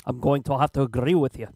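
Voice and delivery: attempted Davos accent, badly faked; Davos impression. Player doing his best Davos impression, shaky Scottish accent. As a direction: try a rough Scottish-sounding Davos. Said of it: "Davos impression here".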